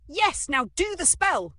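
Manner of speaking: high, loud impatient order; urgent shout. A narrator's reading. Girl hurrying her magician pet, shouting. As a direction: yell it urgently.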